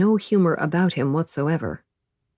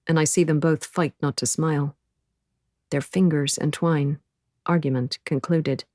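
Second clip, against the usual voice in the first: ordinary delivery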